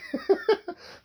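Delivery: a burst of real high laughter. Real, not acted. Laughing at the sign.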